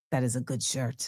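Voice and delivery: low, very soft retold verdict; quiet approval. Man approving the pro-Palestine shirt, imitated low and quiet. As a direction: let it land quietly after a pause. Man's measured reply after tense pause.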